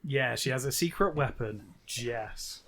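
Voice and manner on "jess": normal talking voice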